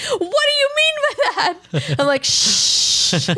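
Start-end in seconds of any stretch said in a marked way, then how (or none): 0.1-1.9 very high, whiny imitation; mock dismay. Voicing exasperated partner in retelling. 2.2-3.3 long, voiceless shushing hiss; drawn-out shush. Retold or imitated. Acting out shushing the partner.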